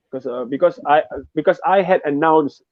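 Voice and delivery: ordinary talk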